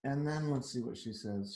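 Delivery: usual speaking voice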